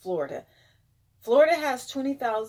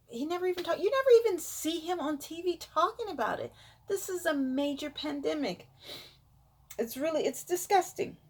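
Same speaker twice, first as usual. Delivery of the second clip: indignant rant; 7 semitones higher, faster than usual. Real, not acted. Ranting about president.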